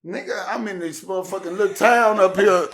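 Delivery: high, loud disbelieving rant; incredulous outburst. Retold or imitated. Rapper to the promoter about his dad, loud disbelief. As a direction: fire it off in disbelief. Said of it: "I'm like".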